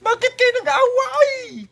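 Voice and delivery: high shouted scolding; shouting match. Pretend. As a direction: yell the question at them. Scolding the fighting toys.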